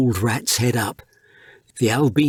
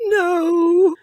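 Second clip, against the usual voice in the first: much higher, louder anguished cry; a mouse breaking down over his friend's body; sobbing no; 4 dB louder than usual. A narrator's reading.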